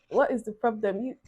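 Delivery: ordinary delivery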